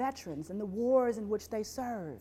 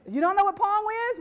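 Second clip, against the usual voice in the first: ordinary talk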